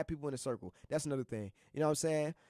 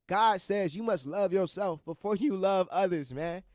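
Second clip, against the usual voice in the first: reciting a verse; 4 dB louder, slower than usual. Shown as a demonstration. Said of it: "I can recite something for y'all right now".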